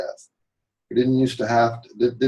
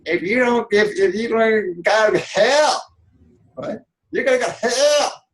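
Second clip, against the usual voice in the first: much higher, put-on preachy voice, slowing down; mock preacher threat; 9 semitones higher than usual. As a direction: play a sanctimonious preacher warning of hell. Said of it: "the passive aggressive motherfuckers".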